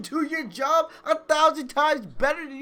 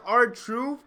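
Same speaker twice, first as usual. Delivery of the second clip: usual speaking voice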